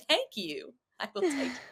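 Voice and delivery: a hint of old-Hollywood transatlantic accent, gracious; Katharine Hepburn transatlantic. Answering fans who hear Hepburn, in a light transatlantic accent. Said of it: "people say I'm Katharine Hepburn".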